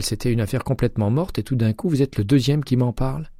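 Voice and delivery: voix de Jay plus aiguë, enjouée; Jay au téléphone. A narrator's reading. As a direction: interlocutrice jouée un ton plus haut, légèrement animée. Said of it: "lui répondit-elle". (Jay, inspectrice, répond à McCaleb au téléphone, voix plus haute)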